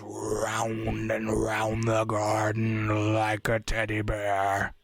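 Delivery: low, slow, wispy drone; spooky ghost voice. Shown as a demonstration. Recreating the film's ghost reciting a nursery rhyme, wispy low voice. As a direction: draw the rhyme out like a disembodied spirit. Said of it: "it's like this wispy, low sound".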